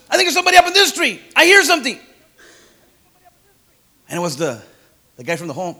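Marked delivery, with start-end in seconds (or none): 0.1-2.0 much higher, louder and fast; excited whisper-shout. Retold or imitated. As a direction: blurt it out excitedly. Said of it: "somebody else says".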